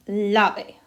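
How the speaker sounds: lave with the weight on LA; LA leaned on, ve left lighter; stress on first syllable. Shown as a demonstration. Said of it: "emphasis is almost always on the second to last".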